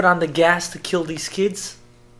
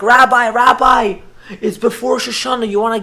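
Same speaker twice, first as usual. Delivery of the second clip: eager calling; 6 semitones higher, 9 dB louder than usual. Retold or imitated. Children run up to their rabbi, eager calling. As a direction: call out like kids running up.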